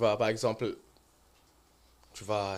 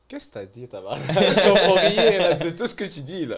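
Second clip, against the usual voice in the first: confused reply; 6 semitones higher, 9 dB louder than usual. Retold or imitated. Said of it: "I was like".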